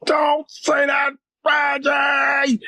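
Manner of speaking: high, long-drawn demon wail; demonic voice. Retold or imitated. Demons swarm round the friend as he objects, demonic wail. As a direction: stretch it into a possessed screech. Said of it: "This demonic voice arose out of him".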